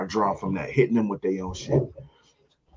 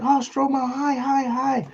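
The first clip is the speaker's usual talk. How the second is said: high-pitched hostess squeal of hello; gushing greeting; slower than usual. Retold or imitated. Hostess greets the arriving comic, gushing. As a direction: imitate an excited hostess, pitched way up.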